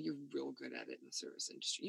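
quiet first pass at the remark. Real, not acted. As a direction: mumble it as a private thought. Repeats compliment two ways.

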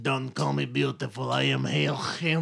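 put-on low, quiet Russian accent; mock Russian man, deep and soft. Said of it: "why is he Russian now".

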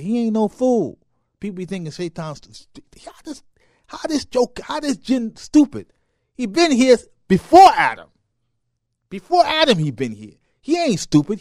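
loud, high preaching; fiery preaching. Acted. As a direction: hammer the point home. Preaching about Shaitan at full force.

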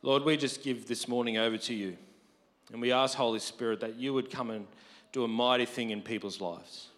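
usual speaking voice

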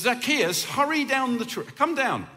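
calling out. Retold or imitated. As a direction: call him down from the branches. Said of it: "And he says".

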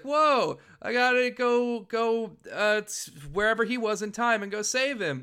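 high-pitched Mega Man impression, kept up throughout. Podcaster acting out the cartoon hero's reaction, high Mega Man voice. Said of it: "Perfect Mega Man voice".

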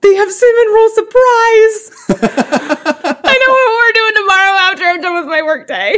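high, thrilled and laughing; excited. Real, not acted. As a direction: gush about the beer. Excited about beer on tap.

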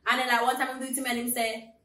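normal talking voice

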